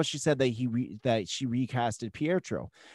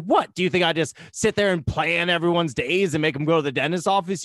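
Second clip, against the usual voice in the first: high, louder exasperated outburst; indignant Wanda; 5 semitones higher, 5 dB louder than usual. Retold or imitated. Fan quoting Wanda snapping at Vision, high and loud. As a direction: snap back, offended and worn out. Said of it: "And she's like".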